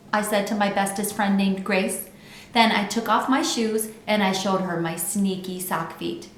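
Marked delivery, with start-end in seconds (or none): none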